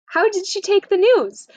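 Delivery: high, eager father's question; excited dad checking on the news; father excited about the wedding plans. Retold or imitated. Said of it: "and says".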